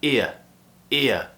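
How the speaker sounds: "ear" as a gliding i-uh diphthong. Shown as a demonstration. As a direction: glide "ear" from i to schwa. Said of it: "i, e".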